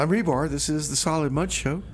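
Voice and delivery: normal talking voice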